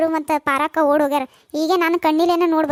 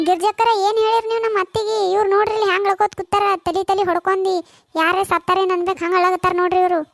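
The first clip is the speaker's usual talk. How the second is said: reproachful daughter-in-law; 3 semitones higher than usual. Acted. Daughter-in-law asks neighbour what she told mother-in-law, reproachful.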